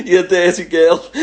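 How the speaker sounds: loud, laughing tease; cheeky laughing jibe; teasing laugh. Real, not acted. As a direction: tease her while laughing. Teasing the trainer.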